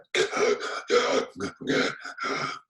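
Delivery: wordless, rasping choking sounds; choked attempt to speak. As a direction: struggle for words and only choke. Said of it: "They're trying to say something. They're, they're like".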